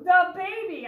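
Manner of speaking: put-on "The baby" yelled loud and high; worried yell. A narrator's reading. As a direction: yell out for a lost sister. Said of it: "I yelled louder than anyone".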